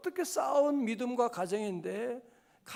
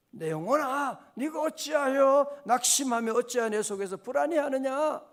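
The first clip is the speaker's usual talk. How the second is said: raised, pleading lament to the soul; David pleading with his soul, raised; heightened lament; 4 semitones higher, 5 dB louder than usual. Retold or imitated. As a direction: cry out to your own soul. Psalm repeated more intensely.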